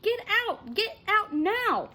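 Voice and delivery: high, loud repeated yell; slow, urgent shout from the hill; desperate yell to swimmers; frantic shouting. A narrator's reading. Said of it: "Chet started shouting".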